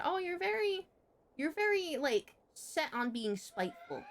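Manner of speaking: high, sing-song sneer; mocking Yappy. Imitating the tutor sneering that she is spiteful, high and mocking. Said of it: "She said".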